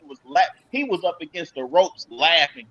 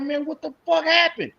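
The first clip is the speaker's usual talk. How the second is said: higher, baffled question; retold disbelief; 7 semitones higher than usual. On the phone with his cousin, high and baffled. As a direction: ask it like you just missed it all. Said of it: "I'm like".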